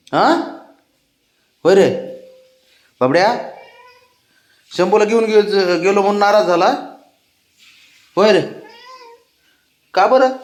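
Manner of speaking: high, sing-song coaxing of a sulking pet. Real, not acted. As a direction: sweet-talk him out of his sulk. Man coaxing his sulking pet.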